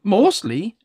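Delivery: put-on high little old elf voice; christmas elf. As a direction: play a hesitant little elf. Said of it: "answered the little old elf".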